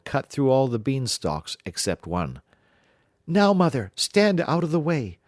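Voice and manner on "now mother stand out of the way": much higher, louder warning; urgent command. Acted. Jack warns his mother to stand clear. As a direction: warn her off sharply. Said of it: "said he".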